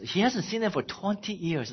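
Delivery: ordinary delivery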